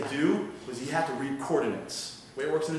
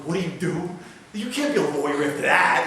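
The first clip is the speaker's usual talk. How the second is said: raised, disbelieving voice; incredulous loud retort; 4 semitones higher, 9 dB louder than usual. Retold or imitated. Telling his friend he can't be a lawyer, loud disbelief. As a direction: scoff at the idea, louder.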